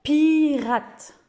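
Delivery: pirate said high and loud; teacher giving an example word. Shown as a demonstration. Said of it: "Comme dans".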